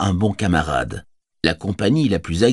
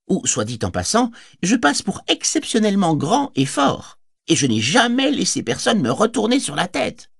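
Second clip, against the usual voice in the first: higher voice, playfully menacing boast; mock-fierce hobbit; 10 semitones higher than usual. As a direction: pretend to be terrifying to a cheeky kid. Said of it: "Pipin prit un visage si dur".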